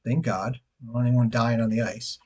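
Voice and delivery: normal talking voice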